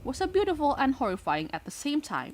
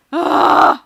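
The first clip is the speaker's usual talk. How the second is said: flustered yelp; 9 semitones higher, 16 dB louder than usual. Real, not acted. Flustered outburst.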